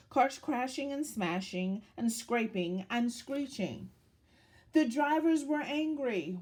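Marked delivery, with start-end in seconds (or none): none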